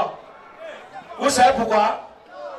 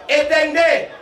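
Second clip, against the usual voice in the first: neighbour's warning shout; 4 semitones higher than usual. Retold or imitated. Electrician on the pole shouting to neighbours to cut power. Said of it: "J'entends seulement".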